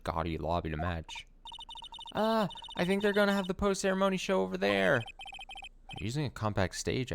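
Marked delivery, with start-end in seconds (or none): 2.1-5.0 put-on much higher, quick bubbly girl voice; perky sidekick girl. Sidekick girl pointing the way, high and bubbly. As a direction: chirp it brightly like an eager young assistant.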